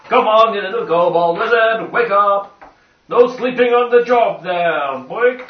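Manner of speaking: rousing call. Acted. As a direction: rouse him from sleep. Player urging sleeping ally.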